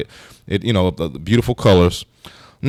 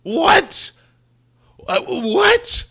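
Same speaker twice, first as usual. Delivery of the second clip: much higher, yelped cries of disbelief; shocked disbelief; much slower than usual. Pretend. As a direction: shriek like the floor fell out from under you. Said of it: "You're gonna be like".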